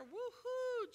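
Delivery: high, loud whoop of joy; the preacher cheering the man getting up; woohoo cheer. Acted. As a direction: whoop like a fan in the stands.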